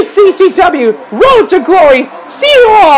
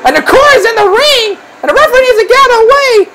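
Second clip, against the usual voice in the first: higher, quick excited call; alarmed play-by-play; 4 semitones higher, much faster than usual. Real, not acted. Commentator calling a ring invasion to fans, alarmed and excited. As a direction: rush the call as trouble erupts.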